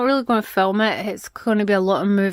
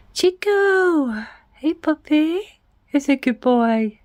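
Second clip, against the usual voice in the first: talking to the dog; 2 semitones higher than usual. Real, not acted. Talking sweetly to her dog.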